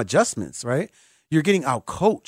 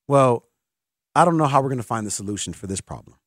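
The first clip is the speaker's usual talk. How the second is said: usual speaking voice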